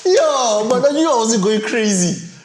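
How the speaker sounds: mock excited outburst. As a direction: hype it up like a thrilled viewer. Retold reaction to Andy Serkis.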